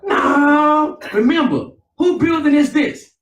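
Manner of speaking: loud call to the church; preaching call-out. Real, not acted. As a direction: rouse the congregation. Preacher calling out to congregation.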